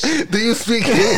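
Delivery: falsetto echo, laughing; high mocking voice. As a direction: copy the squeaky voice.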